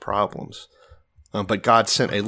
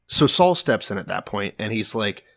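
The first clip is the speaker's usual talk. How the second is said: ordinary talk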